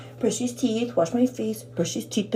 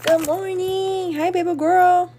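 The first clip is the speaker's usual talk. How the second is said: sweet dog greeting; 8 semitones higher, slower than usual. Real, not acted.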